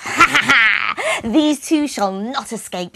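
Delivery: high, loud cackling witch laugh; evil witch cackle. Acted. The witch gloats over the children, loud high cackle. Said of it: "she cackled".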